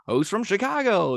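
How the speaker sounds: high, loud arena announcer hype; announcer call. Retold or imitated. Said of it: "he's like".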